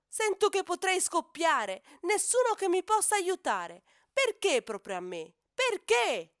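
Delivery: loud, high, rising wail ending on a cry; despairing outcry. Acted. Lonely hippo calf crying out to the sky, despairing. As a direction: let it burst out of you. Ippo's despairing outburst alone.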